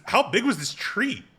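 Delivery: a hammed-up, disbelieving stretched-out repeat; drawn-out mock incredulity. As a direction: push the repeat up high and slow it right down, mock amazed. Repeated question, bigger and drawn out.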